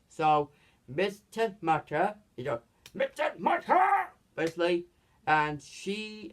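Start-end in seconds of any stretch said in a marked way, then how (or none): 2.9-3.8 high, strained imitation of a shout; mock cry. Storyteller imitating a cry of the name, mock cry. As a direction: call the name out, pitched up.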